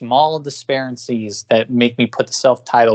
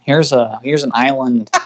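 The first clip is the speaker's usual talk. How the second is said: ordinary delivery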